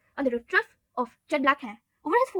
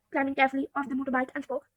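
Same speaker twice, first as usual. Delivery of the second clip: plain reading voice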